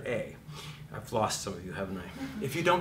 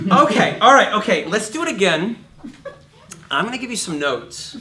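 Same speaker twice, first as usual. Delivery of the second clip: louder, higher mock director voice with a laugh; 9 semitones higher, 13 dB louder than usual. Coach plays a director answering the singer, louder and laughing. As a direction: play the amused director giving notes.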